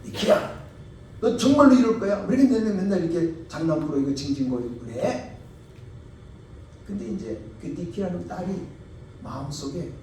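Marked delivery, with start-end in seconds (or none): 0.0-5.4 loud scolding, rising at the start; a father scolding his little girl. Retold or imitated. Father scolding daughter Nikki, loud and cross. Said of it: "탁 때리면서".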